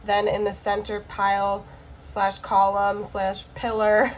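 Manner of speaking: usual speaking voice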